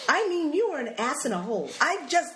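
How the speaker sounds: high, indignant stumbling; mock-angry mother hunting for words; flustered cussing. Acting mother's botched cussing out.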